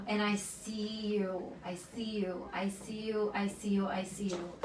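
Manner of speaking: tender, tearful repetition; choked up, quiet. Real, not acted. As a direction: hold back tears as you repeat it softly. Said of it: "I'm emotional".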